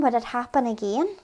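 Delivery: ordinary delivery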